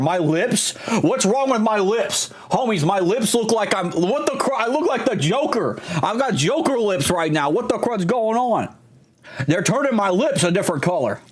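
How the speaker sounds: high, incredulous yelps about his lips; stunned, pitch jumping way up; shocked disbelief. Real, not acted. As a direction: freak out at what you see in the mirror.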